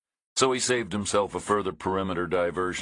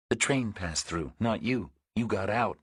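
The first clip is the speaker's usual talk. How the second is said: a dry, wary woman, a touch higher; woman's dry voice; 4 semitones higher than usual. A narrator's reading. Woman complains to Reacher about no signal, dry and higher.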